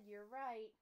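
ordinary delivery

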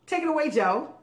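excited. Real, not acted. Handing over to co-host.

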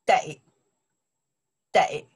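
得 said clearly as a single model syllable, twice; teacher's pronunciation demo. Shown as a demonstration. Teacher modelling 得 for students, clear pronunciation demo. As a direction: model 得 slowly for the class to copy.

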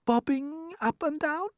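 very high, slow, rising question; murmured worry. A narrator's reading. Ladybird uneasy about their motion, high timid question. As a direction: ask it timidly in falsetto. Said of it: "murmured the ladybird".

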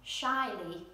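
plain reading voice

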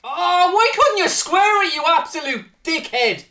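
high, loud exasperated shout; angry shout. Real, not acted. Gamer yelling at his striker for not squaring, angry shout. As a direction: scream it at the screen. Raging at player missing shot.

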